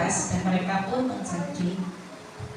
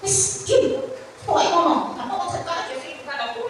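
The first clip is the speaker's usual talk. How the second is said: mock angry pastor; 7 semitones higher than usual. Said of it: "saya dimarahin".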